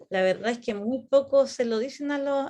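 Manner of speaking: normal talking voice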